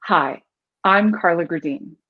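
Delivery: low, measured self-introduction with a pause after Hi. Shown as a demonstration. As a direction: introduce yourself low and grounded, falling at the end. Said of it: "Or the second one I could say".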